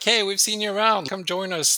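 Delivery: friendly call. Retold or imitated. Barbecue group inviting passerby.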